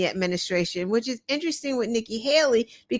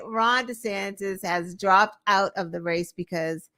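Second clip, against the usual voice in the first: usual speaking voice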